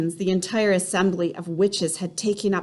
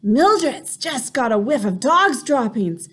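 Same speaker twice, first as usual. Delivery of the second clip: called back; 4 semitones higher, 4 dB louder than usual. A narrator's reading. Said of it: "someone called back to her".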